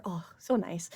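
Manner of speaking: soft unvoiced whisper; whispered contentment. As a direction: breathe it out, cozy. GM describes cozy purring hug.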